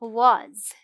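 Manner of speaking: "वॉज" said with a clear z; correct "was". Shown as a demonstration. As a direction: say "वॉज" carefully, ending in z. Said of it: "हम क्या पढ़ेंगे".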